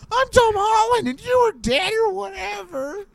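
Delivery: very high, squeaky mock Tom Holland; hammed-up squeal of a Tom Holland voice; Tom Holland impression. Comic recounting a film plot as Tom Holland, squeaky impression. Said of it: "he just sounds like this".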